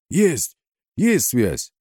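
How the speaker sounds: high, triumphant cry; joyful shout. A narrator's reading. Radio operator reports connection, shouting joyfully. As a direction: call out the good news. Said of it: "радостно закричал из глубины Кунга".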